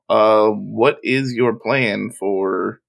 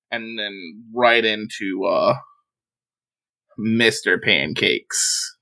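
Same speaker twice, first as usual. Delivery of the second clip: ordinary delivery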